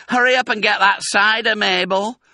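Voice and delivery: high, loud call from afar; woman calling out. A narrator's reading. Woman in house above calls Mabel. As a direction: holler to someone in another room. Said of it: "a woman's voice calling out".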